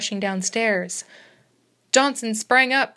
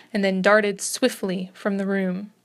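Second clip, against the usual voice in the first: plain reading voice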